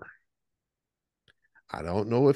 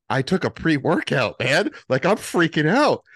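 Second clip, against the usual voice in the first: raised, emphatic mock panic; higher-pitched, worked-up explanation; retold freak-out; 6 semitones higher, 4 dB louder than usual. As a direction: protest it with mounting agitation. Acts out panicked explanation to roommate.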